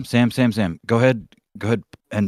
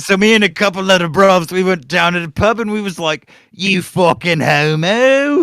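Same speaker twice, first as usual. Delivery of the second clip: loud, high British pub yob; mock British lad accent; 6 semitones higher, 7 dB louder than usual. Lad recounting a pub night, loud mock British accent. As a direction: crow it in a hammed-up British accent. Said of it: "that kind of British accent".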